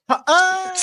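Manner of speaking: high, loud "ha-AH" as if socked; laugh cut by punch. Shown as a demonstration. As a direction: laugh that turns into a punched yelp. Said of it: "somebody gets, like, punched".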